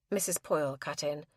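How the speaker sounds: plain reading voice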